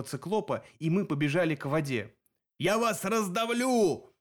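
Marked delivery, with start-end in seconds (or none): 2.6-4.1 high, drawn-out threatening wail; yelling cyclops. A narrator's reading. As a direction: howl the threat slowly, like a wounded monster. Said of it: "вопил Полифем".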